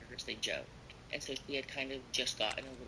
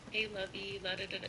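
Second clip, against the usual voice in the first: high, sing-song sweet-talk imitation; mock her gushing pet names; imitating an overly sweet coworker; sugary Laura voice; 8 semitones higher, 3 dB louder than usual. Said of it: "'cause that's how she talks".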